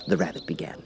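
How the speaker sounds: usual speaking voice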